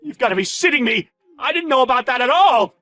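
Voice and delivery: high, loud horrified shout; frantic, shocked protest; panicked outburst. Acted. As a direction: yell in alarm, pleading ignorance. Boss panics at the revelation.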